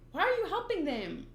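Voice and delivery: ordinary talk, nothing marked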